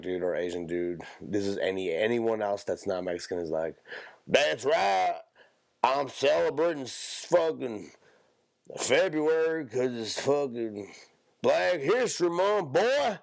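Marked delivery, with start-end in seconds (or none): 4.3-13.2 hillbilly caricature, pitch climbing at the end. Acted.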